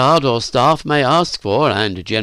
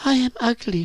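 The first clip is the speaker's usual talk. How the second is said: put-on high, self-pitying girl's voice; dejected; 13 semitones higher than usual. A narrator's reading. Virginia drops her head at Phyllis's laugh, dejected. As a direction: say it high-pitched, head dropped. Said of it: "Virginia dropped her head".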